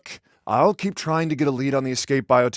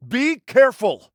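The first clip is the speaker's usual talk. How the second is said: high, loud call of caution; loud, high-pitched warning; shouted warning; 11 semitones higher, 6 dB louder than usual. Acted. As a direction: call it out urgently. Calling out a warning to Delson.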